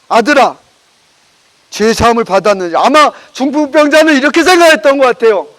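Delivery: usual speaking voice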